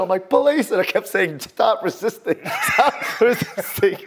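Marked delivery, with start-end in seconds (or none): 0.3-0.7 much higher, louder retold yell; screamed police call. Officer screaming while trying to stay professional, mock yell. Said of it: "I'm screaming and I'm trying to stay professional". 1.4-4.0 high-pitched mock yell, slowing on the repeat; imitated screaming. Officer repeating an order while handcuffing a man, mock scream. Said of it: "I'm screaming at the top of my lungs".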